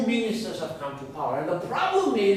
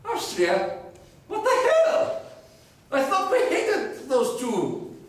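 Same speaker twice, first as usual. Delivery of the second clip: indignant Spain; 10 semitones higher than usual. Acted. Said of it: "they're like".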